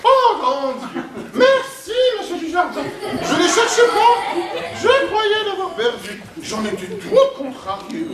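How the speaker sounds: high posh bourgeois voice, laughing; posh French drawl. Shown as a demonstration. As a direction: camp up a high fussy aristocrat. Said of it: "je vais te montrer".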